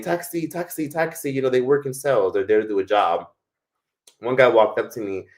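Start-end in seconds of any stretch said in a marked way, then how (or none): 0.0-1.3 taxi touts calling. Retold or imitated.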